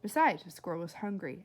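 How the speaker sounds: plain reading voice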